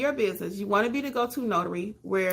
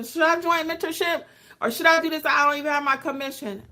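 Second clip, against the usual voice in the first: anxious viewer; 8 semitones higher than usual. Retold or imitated. Viewers asking the host, in a higher worried voice. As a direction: play the nervous beginner unsure what to do. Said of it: "People say".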